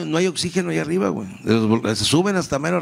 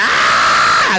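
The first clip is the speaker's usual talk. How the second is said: loud swooning fan scream; 6 dB louder than usual. Retold or imitated. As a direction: shriek like a star-struck fan. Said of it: "mi mamá adelante decía".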